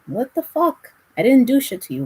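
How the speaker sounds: high, indignant mock outburst; a burst of offended disbelief, pitched high; indignant retort. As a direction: throw it back at a rude stranger, offended. Retorting to a rude stranger.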